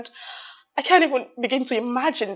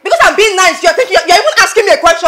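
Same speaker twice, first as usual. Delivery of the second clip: angry shouting; 3 semitones higher, 12 dB louder, faster than usual. Acted. Angry outburst at friends.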